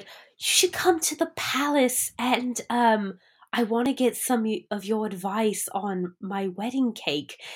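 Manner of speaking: soft, mock British accent as the duchess. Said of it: "everyone's generically British".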